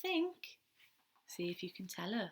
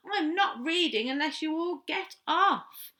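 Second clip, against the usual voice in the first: exasperated shout; 7 semitones higher, 9 dB louder than usual. Acted. Baby Owl squashed, shouting.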